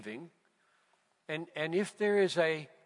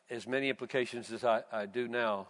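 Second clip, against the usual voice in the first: ordinary talk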